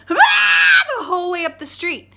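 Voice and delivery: very high loud shriek running away; fox scream fading off. Retold or imitated. Vlogger imitating the fox screaming up the street.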